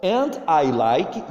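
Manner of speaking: teacher modelling And I like slowly; slow model reading. Shown as a demonstration. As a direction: stretch it out for pupils to copy. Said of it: "Pronuncia a palavra".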